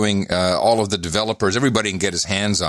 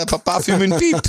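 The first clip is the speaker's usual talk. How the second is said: high, hammed-up French accent; 9 semitones higher than usual. Host reciting schoolbook French as a joke, hammed-up French accent. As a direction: overdo the French, pitched high. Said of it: "A little bit of a French accent".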